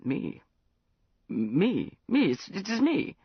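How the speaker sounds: put-on slurred, loose drunken voice; tipsy caller on the phone at dawn. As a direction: sound well into the bottle. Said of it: "Prince, you sound terribly drunk".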